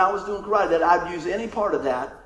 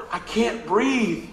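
mock gasping; 2 semitones higher, much slower than usual. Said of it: "I go".

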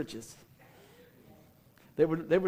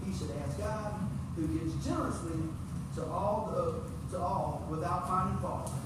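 scripture reading; 8 dB quieter than usual. Acted. Preacher reads James chapter one to the congregation, measured. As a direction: read the verses steadily to the congregation.

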